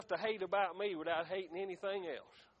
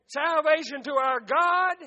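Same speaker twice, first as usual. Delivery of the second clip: louder, ringing proclamation; loud cry; 7 semitones higher, 11 dB louder than usual. A narrator's reading. Multitude crying before the throne, loud urgent cry. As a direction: cry it out harder, pushing up on God. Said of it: "cried with a loud voice".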